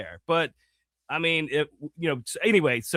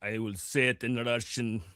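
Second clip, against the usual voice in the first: lower, put-on Russian accent; 5 semitones lower, 8 dB quieter than usual. Asked how to end the show, answers in a put-on Russian accent. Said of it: "with a Russian accent".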